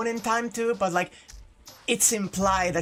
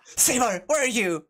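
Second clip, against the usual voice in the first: raised, higher searching call; 5 semitones higher, 3 dB louder than usual. Acted. Shiro searches the dark for Saber, calling out. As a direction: call out for someone missing.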